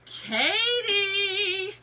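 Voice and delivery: gasp, then a high loud cry of a name; gasp and shout. Real, not acted. Reacting to a viewer comment, delighted high cry.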